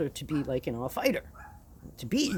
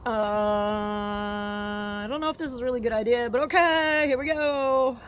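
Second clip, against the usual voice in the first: nervous voice rising louder, higher; hesitant inner voice; 5 semitones higher, 4 dB louder, slower than usual. Retold or imitated. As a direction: go from unsure to psyched up. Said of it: "Me and myself were like".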